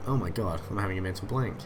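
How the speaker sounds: usual speaking voice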